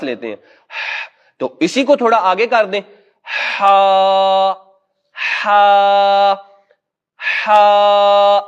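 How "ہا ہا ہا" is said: ہا pushed out on a long breath, louder; breathy ha. Shown as a demonstration. Teacher shows how to voice ha from a breath, loud. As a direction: breathe out ہا as an extended sigh from the throat. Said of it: "لمبا سانس لینے کے بعد اس کو تھوڑا سا آگے بڑھا دیں".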